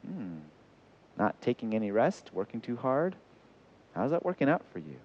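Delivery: soft, understanding question; gracious asking. Shown as a demonstration. Counselling someone who feels like a failure, gracious and gentle. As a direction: ask gently, without accusing. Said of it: "You just graciously go".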